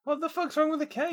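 very high, incredulous protest; high-pitched indignation. Real, not acted. Friend defending the magic cape, very high indignant outburst. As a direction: shoot right up high in disbelief.

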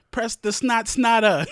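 high, loud, slow hammed-up announcer; mock podcast intro. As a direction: boom out a silly show name. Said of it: "say some shit like".

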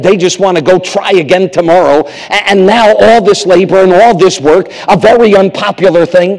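usual speaking voice

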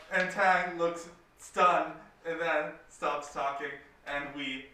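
normal talking voice

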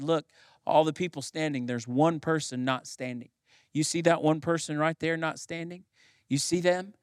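usual speaking voice

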